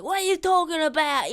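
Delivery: high-pitched mock outrage; angry thief. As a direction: play an irate thief heckling. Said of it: "a bunch of angry thieves".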